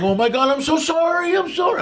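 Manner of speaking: high, wailing mock sobs; retold crying apology. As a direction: plead for forgiveness, imitating your own crying. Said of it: "I literally was crying".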